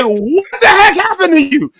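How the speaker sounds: high, drawn-out retold alarm; shocked disbelief. Husband retelling seeing his wife's bloody lip, shocked.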